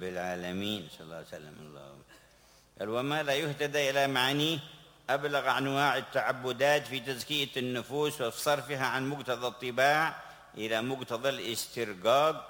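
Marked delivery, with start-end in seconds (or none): 2.9-12.4 loud, high declaiming from a text; raised, ringing recitation of a scholarly text; forceful read-aloud. Acted. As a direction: project the reading to the whole gathering. Reads aloud from the book.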